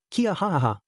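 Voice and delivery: high mock cackle of a villainess; wicked cackle at their despair. A narrator's reading.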